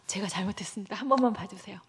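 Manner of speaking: pleading apology. Acted. Moses begging God for mercy.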